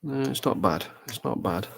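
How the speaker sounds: talking around a paintbrush; mouth full. Real, not acted. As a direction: brush clenched in teeth. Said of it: "sorry, I got a brush in my mouth".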